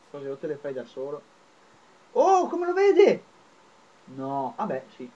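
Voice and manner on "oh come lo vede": surprised. Real, not acted. Shocked at the game screen.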